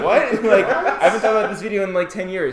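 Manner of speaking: loud, high, laughing disbelief; laughing surprise. Retold or imitated. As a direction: blurt it out through laughter. Mock reaction to deletion notice.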